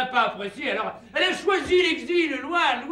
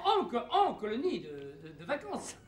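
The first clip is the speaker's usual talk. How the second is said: ordinary delivery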